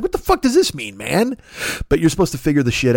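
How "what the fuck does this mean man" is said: high baffled exclamation. Retold or imitated. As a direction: react like the text makes no sense. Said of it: "And you're like".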